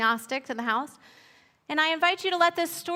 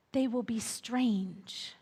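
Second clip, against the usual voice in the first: slow hushed prophecy; 2 semitones lower, 6 dB quieter, much slower than usual. Acted. Old Turtle prophesies.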